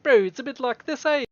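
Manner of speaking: faux-idiot New Zealand bro accent; hammed-up New Zealand street accent; New Zealand fob accent. Shown as a demonstration. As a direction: do a dopey Kiwi fob accent. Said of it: "accent in New Zealand".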